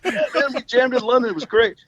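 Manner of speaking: laid-back Scott. Retold or imitated. Scott telling Robbie about London, lightly voiced and offhand. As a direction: shrug it off casually. Scott casually recounts jam.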